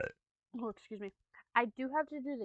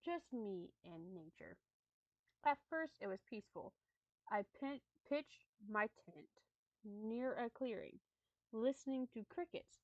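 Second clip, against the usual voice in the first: measured, slightly hushed storytelling; story narration; 4 dB quieter, slower than usual. Acted. Streamer reads creepy camping story to viewers, storytelling voice. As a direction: read it like a campfire tale.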